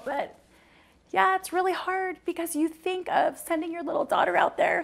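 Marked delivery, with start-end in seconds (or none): 1.1-4.8 fighting back tears, pitch climbing high; holding it together as the voice cracks up high; voice breaking. Real, not acted. Mother picturing her daughter in the world, voice breaking. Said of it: "I don't wanna get emotional".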